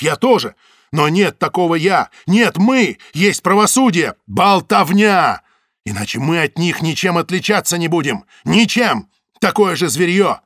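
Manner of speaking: loud, high insistent shouting; heated plea. A narrator's reading. Zaytsev argues with Samoylov, loud heated plea. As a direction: argue hard for justice.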